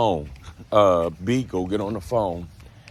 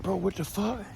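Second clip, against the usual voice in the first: high, startled disbelief; 11 semitones higher, 7 dB quieter than usual. Retold or imitated. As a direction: pitch jumps way up in shock. Retelling his shocked reaction.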